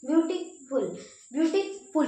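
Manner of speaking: Beautiful in two separate pieces, beauty then फुल; pause after beauty. Shown as a demonstration. Teacher demonstrating syllables to students, pausing after beauty. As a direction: break beautiful just before फुल, both times. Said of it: "beauty के बाद थोड़ा सा रुक रहे हैं".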